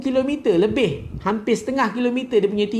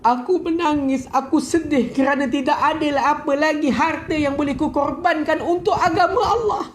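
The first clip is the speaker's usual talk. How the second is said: higher, choked tearful reply; tearful answer; 6 semitones higher than usual. Retold or imitated. Khadijah answers her husband the Prophet, tearful and rising. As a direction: answer through tears, rising. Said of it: "Khadijah bagi tahu".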